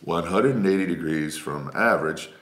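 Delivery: low, goofy voice reading a channel name; silly voice. Shown as a demonstration. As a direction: read it in a deep silly character voice. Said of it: "Maybe I'll start doing the voices".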